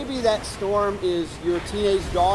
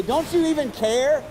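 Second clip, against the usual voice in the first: raised, high-pitched frustrated plea; desperate yell; 7 semitones higher, 5 dB louder than usual. Retold or imitated. Disciples yelling to Jesus in the storm, desperate yell. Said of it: "they yell out to Jesus".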